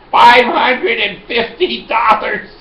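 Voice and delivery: high, slow, drawn-out shout; shouted price. Retold or imitated. Pharmacy customer's second shocking price, shouted out slowly. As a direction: holler the price for comic shock.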